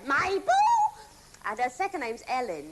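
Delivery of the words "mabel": calling out. Retold or imitated. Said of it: "lean out my Hollywood doors and go".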